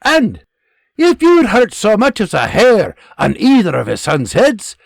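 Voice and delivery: loud, high Scottish threat; Scottish sergeant warning. Acted. Scottish sergeant warning privates about William Bruce. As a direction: drive the warning home, Scottish.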